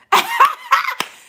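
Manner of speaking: a big squealing laugh; loud high laugh. Real, not acted. Cracking up at a viewer's comment, loud high laugh. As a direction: burst out in a high cackle.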